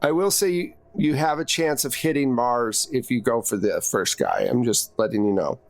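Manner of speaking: usual speaking voice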